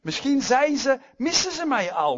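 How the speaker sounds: high, loud preaching cry; impassioned outcry. Acted. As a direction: call it out urgently as Jonah. Preacher voices Jonah's urgent prayer.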